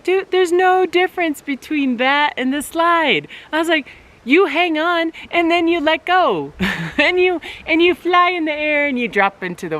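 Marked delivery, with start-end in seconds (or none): 0.0-8.5 high, eager pep talk; excited coaxing. Retold or imitated. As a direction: talk a scared kid into the rope swing. Mother coaxing daughter to rope swing.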